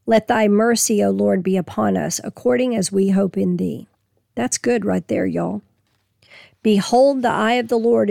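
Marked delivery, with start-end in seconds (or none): none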